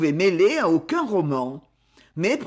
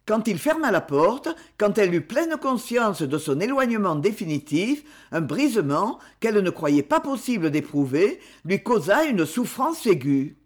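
ordinary delivery, nothing marked

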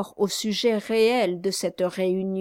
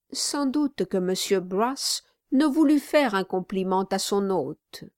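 ordinary delivery, nothing marked

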